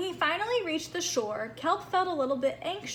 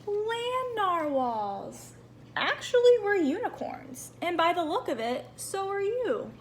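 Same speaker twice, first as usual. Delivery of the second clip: lightly voiced unicorn; 6 semitones higher than usual. Unicorns greet Kelp.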